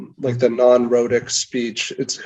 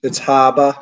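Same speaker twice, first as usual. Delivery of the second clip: Maine accent. Shown as a demonstration. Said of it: "Is it harbor or harbor?".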